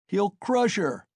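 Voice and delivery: high-pitched cry from an onlooker; crowd shout. A narrator's reading. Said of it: "was shouted round him".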